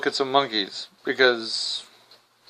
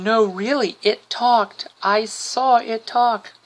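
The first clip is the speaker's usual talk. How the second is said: put-on little girl; 5 semitones higher, slower than usual. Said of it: "the little girl's like going".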